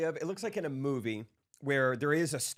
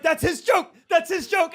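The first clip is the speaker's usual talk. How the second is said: excited shout; 9 dB louder than usual. Retold or imitated.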